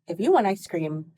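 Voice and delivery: ordinary talk, nothing marked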